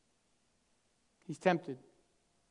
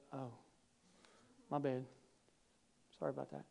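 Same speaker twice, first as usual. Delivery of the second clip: meek apology; 5 semitones lower, 15 dB quieter, faster than usual. Pretend. Parent meekly backing down.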